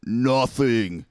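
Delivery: imitated angry singer's bark, drawn out; hardcore frontman's brutal cry. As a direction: throw out one word like a brutal vocalist. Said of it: "you just hear him say".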